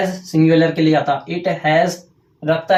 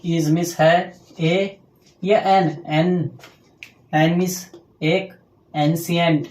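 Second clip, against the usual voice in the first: slow dictation; 3 dB quieter, much slower than usual. Shown as a demonstration. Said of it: "spelling out translation".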